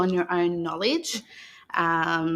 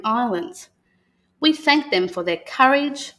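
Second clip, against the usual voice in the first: put-on reading to children. [name] reads the Welcome to Country book to the children, in a calm storytelling voice. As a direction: read it aloud like a welcome ceremony to young listeners.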